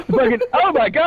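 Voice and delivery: normal talking voice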